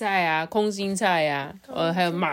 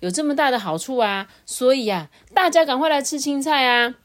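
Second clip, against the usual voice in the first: lightly voiced doctor, higher and sing-song; doctor's announcement; 7 semitones higher, 4 dB louder than usual. Doctor urges villagers to eat greens, lightly acted announcement. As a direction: pitch it up, urging everyone along. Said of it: "他说".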